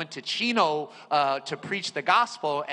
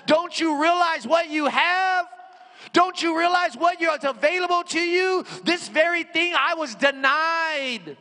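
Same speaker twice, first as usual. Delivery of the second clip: high, loud mock scolding as Moses; Moses screaming; 10 semitones higher, 4 dB louder than usual. Imagined Moses scolding today's church, mock screaming. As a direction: rebuke them as an outraged prophet would. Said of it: "He would be screaming at us".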